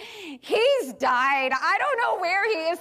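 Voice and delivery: half-playful alarm that he's died; panicked worry. Retold or imitated. Mother waiting outside the candy store, fearing the worst. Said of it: "I'm like".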